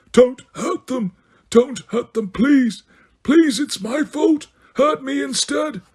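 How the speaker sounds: high, slow moaning plea for mercy. A narrator's reading. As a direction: moan it out, begging for others. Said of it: "as he moaned".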